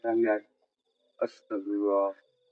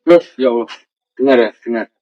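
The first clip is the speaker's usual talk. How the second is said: sudden louder alarmed exclamation to the viewers; startled loud call; 9 dB louder than usual. Real, not acted. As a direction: jolt of real fright, voice jumps louder. Ghost hunt, hears noises nearby.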